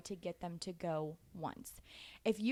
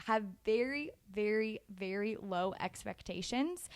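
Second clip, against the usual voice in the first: slow down and lean on each very; a slow, emphatic very very very; drawn-out very. Shown as a demonstration. Stressing how low to set expectations.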